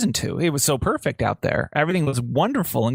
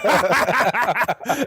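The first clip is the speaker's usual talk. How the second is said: laugh; 9 semitones higher than usual. Real, not acted. Laughing after retold panic.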